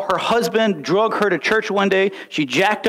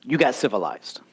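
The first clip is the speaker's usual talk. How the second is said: low, quiet retold trainer's verdict; dead-serious verdict; 7 semitones lower, 5 dB quieter than usual. Trainer confronting Rocky face to face, dead-serious verdict. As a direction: deliver it low and soft, straight at him. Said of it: "looks him dead in the face".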